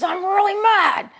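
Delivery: put-on loud, high angry child voice; child yelling he's mad; angry kid. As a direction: shout it like a furious little boy. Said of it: "he was really angry".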